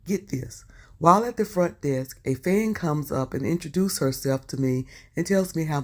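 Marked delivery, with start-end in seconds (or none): none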